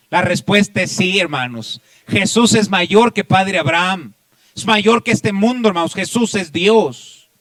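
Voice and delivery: loud, high, slow preacher's cry; fiery sermon climax; full-force preaching. Acted.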